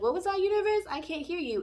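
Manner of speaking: loud, high playful shout; mock deaf reply. You pretend not to hear the universe, loud and high. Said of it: "And then you'd be like".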